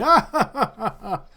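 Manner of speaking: high, hammed-up lord's laugh falling away; acted hearty "ah-ha-ha"; belly laugh. Lord Quentin laughing at the lady's whisper, hammed-up belly laugh. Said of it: "and then he holds his belly".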